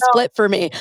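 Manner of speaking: usual speaking voice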